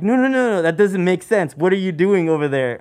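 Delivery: high, loud frantic no no no; loud panicked disbelief that eases off; panicked denial. Retold or imitated. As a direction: rush it like you've just seen a ghost. Frightened coworkers retold.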